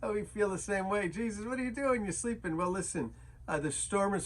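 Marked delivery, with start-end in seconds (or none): 1.1-2.6 high, pleading "what are you doing"; playful mock panic, much higher; exasperated disciples. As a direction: whine up at a sleeping Jesus in mock alarm. Voicing disciples' panicked complaint to Jesus.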